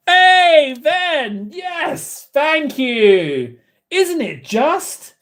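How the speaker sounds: very high, sing-out hello and thanks; high-pitched, drawn-out delight; delighted greeting. Real, not acted. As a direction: greet them with gushing glee.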